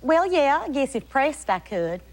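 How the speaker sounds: put-on Texas drawl on request; Texas accent. Shown as a demonstration. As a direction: answer in a playful Texas accent, stretching the vowels. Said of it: "Can you do a Texas accent?".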